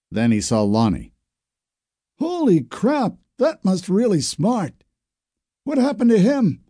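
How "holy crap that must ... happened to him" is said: put-on teen shocked at the burned neighbour; shocked young man. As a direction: gape at the burns.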